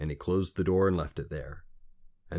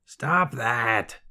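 sharp, higher croak; croaky little man; 5 semitones higher, 4 dB louder than usual. A narrator's reading. Little man to sobbing girl, sharp command.

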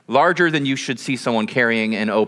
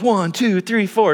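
raised, put-on eager counting; playing along excitedly; 6 semitones higher, slower than usual. Man playing the part for the camera, mock-eager counting. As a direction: count higher, acting impressed. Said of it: "trying to kind of play the part".

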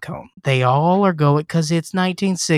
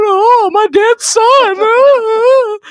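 very high, slow, loud mock wail; hammed-up grief; 7 dB louder, much slower than usual. Diane Lane mourning her dead son, hammed-up slow falsetto wail. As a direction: stretch it out in a hammed-up falsetto lament. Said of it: "Diane Lane's just going".

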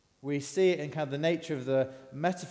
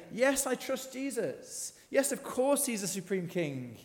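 eager profession; 8 semitones higher than usual. Retold or imitated. Preacher voicing what believers want to say, eagerly high. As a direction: pitch it up like a keen believer insisting.

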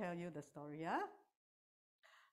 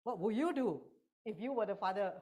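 ordinary delivery

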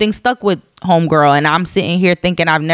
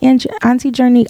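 put-on young niece; 7 semitones higher than usual. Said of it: "she's like".

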